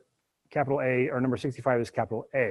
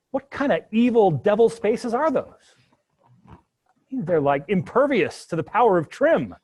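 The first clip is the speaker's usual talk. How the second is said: mock outrage; 6 semitones higher, 6 dB louder than usual. Mock outrage at stubborn spaces.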